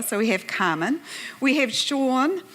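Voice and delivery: usual speaking voice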